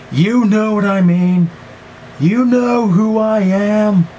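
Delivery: high, slow, put-on voice; mock dramatic voice. Repeating to viewers in a hammy put-on voice.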